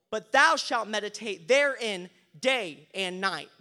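loud, slow, emphatic Bible reading; preacher proclaiming scripture in measured phrases. Acted. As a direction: deliver the verse with weight on key words. Reads scripture to congregation.

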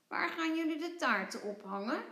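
high, bright-eyed tiny creature voice; playful little creature calling; little creature Vlos. Acted. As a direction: squeak it out like a small cheeky critter. Vlos asks curiously.

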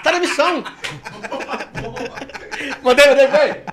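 high, loud and laughing; hyped laughing punchline. Real, not acted. Hyping up Danilo to the podcast crew, laughing. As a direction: crow it out while cracking up.

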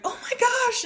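high-pitched amazed gasp of recognition, retold; retold surprise. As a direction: act out the surprise, pitch leaping up. Retells surprise at recognizing the ladies.

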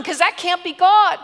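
ordinary talk

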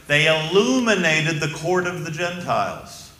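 slow, swelling pulpit delivery; rising preaching. Acted. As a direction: build the sermon to a ringing peak. Preacher building to climax.

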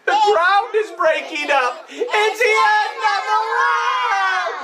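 teacher leading the class in a panicked chant; mock panic. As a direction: cry out like the sky is falling. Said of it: "they said".

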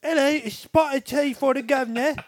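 a mock posh English greeting, drawn out and loud; British accent. Shown as a demonstration. Showing off his British accent to the friends, hammed-up British. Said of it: "listen to my British".

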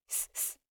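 soft voiceless hiss to stop them; shushing. A narrator's reading. Veerraju trying to stop him, soft shushing. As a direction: hush them with short soft hisses. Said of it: "వారించే ఉద్దేశంతో".

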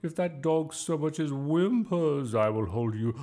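slow, loud, high warning; threatening. A narrator's reading. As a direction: threaten the boy with drawn-out menace. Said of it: "Miss Boot glared at Bertie".

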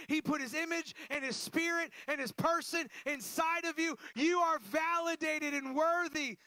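a pastor's passionate declaration; full-force preaching. Acted. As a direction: press the promise home to the congregation. Pastor building to a climax.

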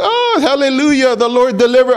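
high-pitched mock rejoicing; jubilant praise. Freed people celebrating on the beach, jubilant. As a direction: cry hallelujah like someone just rescued.

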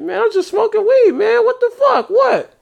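very high whiny protest. Retold or imitated. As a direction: squeal it like a stoned guy caught. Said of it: "He's like".

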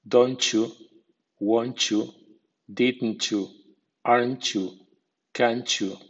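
"don't you" run together as "donchu"; ch blend. Shown as a demonstration. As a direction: join t and y into a ch sound: "won't you" like "wonchu". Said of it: "T más I suena ch".

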